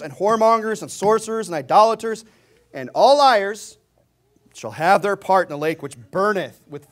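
thundering sermon reading; fiery preaching. Acted. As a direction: hammer each sin from the pulpit. Preaching at full force.

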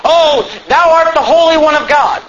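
high, acted outcry; devil speaking through man. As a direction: cry out as the devil inside him. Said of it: "the devil says through him".

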